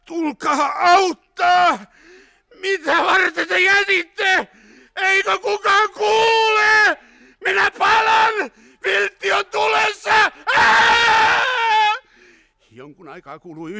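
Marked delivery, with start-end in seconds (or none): 0.0-10.3 high, slow, drawn-out screams of agony; screaming. A narrator's reading. Burning man abandoned in the car, screaming. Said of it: "tukahtunutta yskintää ja huutoa". 10.6-11.6 high, loud, sustained scream; agonized scream. A narrator's reading. Man burning in the car, screaming. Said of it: "kunnes se muuttui villiksi karjunnaksi".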